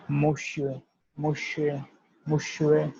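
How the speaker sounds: monsieur said as "muh-syuh"; French monsieur drill. Shown as a demonstration. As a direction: model monsieur for students. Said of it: "it will pronounce it as".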